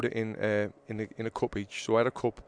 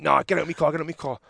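pitched-up, insistent command; retold angry order; 4 semitones higher, 5 dB louder, faster than usual. Driver throwing passenger out of the car, angry. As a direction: order him out, imitating an irate driver.

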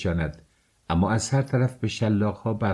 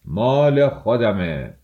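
slow, drawn-out shout of ownership; defiant shout; 4 dB louder, slower than usual. A narrator's reading. Mikolka answering the crowd about his horse, shouting. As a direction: stretch the words out defiantly, calling loudly. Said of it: "فریاد زد".

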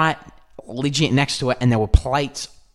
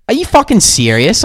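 high, louder incredulous challenge; retold outraged disbelief; 9 semitones higher, 5 dB louder than usual. Retelling how he confronted the man who kicked his stuff, outraged disbelief. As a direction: confront him in disbelief, re-enacted. Acting out own outrage at culprit.

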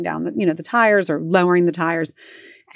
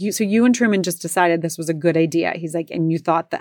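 normal talking voice

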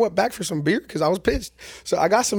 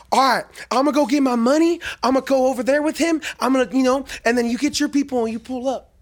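imitating a tweaker girl, pitched higher and louder; hammed-up hustler pitch, voice raised up high; mock tweaker chick; 8 semitones higher, 3 dB louder than usual. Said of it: "she's talking about".

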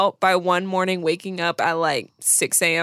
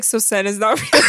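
trying not to laugh. Real, not acted. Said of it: "can't even say it".

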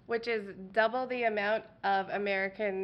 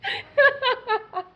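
fighting back laughter while talking; stifled giggles; 4 semitones higher than usual. Real, not acted. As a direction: giggles breaking through the words. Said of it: "trying not to laugh really hard".

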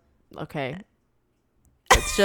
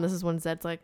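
ordinary talk, nothing marked

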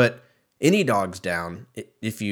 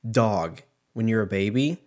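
ordinary talk

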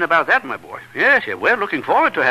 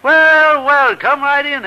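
big warm hello, louder and higher; hearty welcome; 9 semitones higher, 4 dB louder, much slower than usual. Acted. Father welcomes Elwood at the door, hearty and booming. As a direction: boom out a jovial welcome to the guest.

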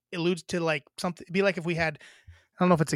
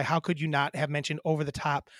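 normal talking voice

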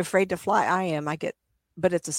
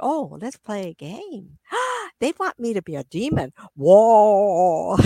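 mock spooky spirit; 2 semitones higher, 6 dB louder, slower than usual. Spirits playing along mockingly.

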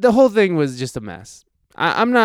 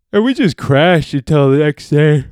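talking through a yawn; mid-yawn, sleepy words; 4 dB louder than usual. Real, not acted. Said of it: "excuse me, I'm yawning".

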